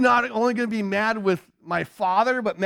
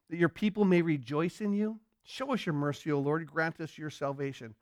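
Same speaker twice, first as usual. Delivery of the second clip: soft prayer; 4 semitones lower, 7 dB quieter than usual. Acted. Quoting psalm prayer softly.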